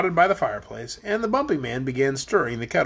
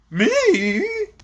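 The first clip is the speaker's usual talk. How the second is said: high, wobbly one-word question; bumpy voice; 14 semitones higher, 3 dB louder than usual. A narrator's reading. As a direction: answer up high as the bumpy man. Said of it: "said in a rather bumpy voice".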